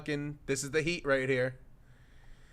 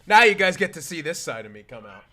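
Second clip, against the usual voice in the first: usual speaking voice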